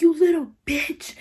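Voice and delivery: high-pitched mock scream, kept at normal volume; retold outburst, held back in volume. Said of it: "just started screaming at her".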